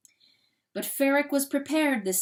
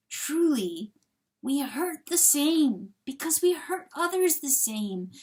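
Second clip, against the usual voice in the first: gentle suffering coyote; 6 semitones higher than usual. Acted. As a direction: console him with earnest, halting compassion. Coyote figure consoles the rooster.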